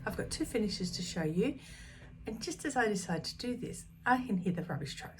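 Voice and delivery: normal talking voice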